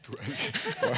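tearful, voice breaking; close to tears. Real, not acted. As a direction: voice cracking high with tears. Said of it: "weinst du jetzt schon".

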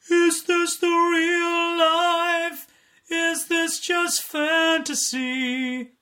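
high, slow dopey singing. Shown as a demonstration. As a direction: sing it like a dopey character. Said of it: "So you gotta make it a little bit dopey".

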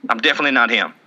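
ordinary delivery

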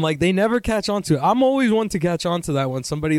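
ordinary talk

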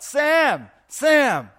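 shouting a name out the window at full volume; wife shouting the neighbour's name from the window; yelled out. Acted. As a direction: yell for the neighbour across the yard. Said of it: "and yelled out".

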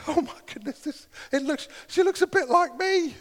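put-on high, wide-eyed disbelief at the first baby; awed new dad. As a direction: gasp it out like you just became a dad. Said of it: "they hand the".

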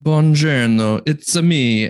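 playful Italian-American mobster hello; Brooklyn Italian accent. Pretend. Cohost greets the show in a joking Brooklyn Italian accent. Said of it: "Brooklyn Italian accent".

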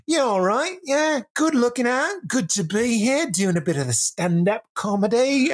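high, lilting mock British accent; chirpy British stand-up greeting; British comedian. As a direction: caricature a cheery British comic. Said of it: "so charmed by British comedians".

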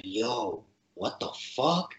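hushed, drawn-out disbelief; retold amazement. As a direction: imitate a stunned listener, softly and slowly. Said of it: "opening her eyes, kinda like".

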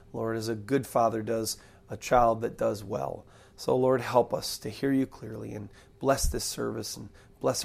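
low, soft praying voice; prayer. Acted. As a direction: pray humbly to the Lord, quietly and low. Prays aloud before the sermon.

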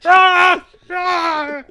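loud, very high comic "ah"; hammed-up high wail; mock cry. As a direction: yelp in a put-on cartoon voice. Mock improv noises.

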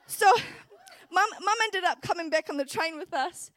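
normal talking voice

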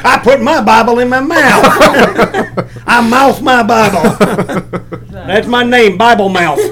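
lightly hammed-up Bible-mouth character; comic Bible pitchman. Comedian improvising a Bible commercial as a goofy character. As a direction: play a proud oddball announcing himself.

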